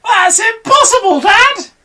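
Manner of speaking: put-on very high, loud child monkey voice; little monkey protest. Small monkey protests to his dad, high and squeaky.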